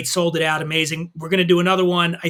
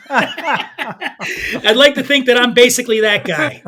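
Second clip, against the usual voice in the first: laughing; 5 semitones higher, 4 dB louder, slower than usual. Real, not acted. Laughs after the punchline.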